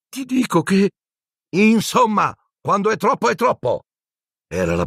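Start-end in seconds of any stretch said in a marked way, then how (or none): 0.1-0.9 put-on urgent boy. Ron cut off. 1.5-3.8 high sharp stern teacher; stern teacher losing patience; angry scolding. Acted. McGonagall scolding.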